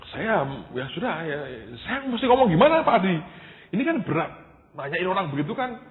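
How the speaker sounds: high-pitched, overwhelmed pleading; daunted protest. Retold or imitated. Young assistant to Pak Adi on questioning a man, daunted protest. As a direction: balk at the task, voice shooting up.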